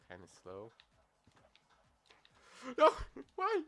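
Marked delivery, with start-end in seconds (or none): 2.8-3.6 high, fast, whining complaint; whiny high voice. Real, not acted. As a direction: whine it out in frustration. Frustrated at own mistake.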